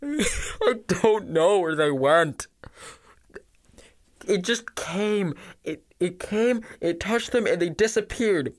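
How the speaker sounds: high, slow tearful wail; terrified survivor breaking down in tears; sobbing. Acted. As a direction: sob out the account, begging. Blinded survivor sobbing out his story.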